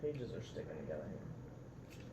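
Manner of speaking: plain reading voice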